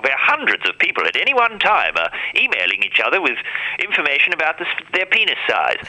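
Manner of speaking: hint of a 1940s newsreel announcer; lightly voiced old newsreel narration. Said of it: "that old style nineteen forties voiceover".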